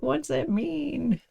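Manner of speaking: high, slow, puzzled mock question; hammed-up worried inner voice; mock fretting self. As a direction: play the part of the mind clutching for meaning. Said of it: "attach to it and be like".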